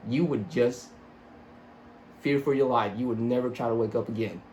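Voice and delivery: ordinary talk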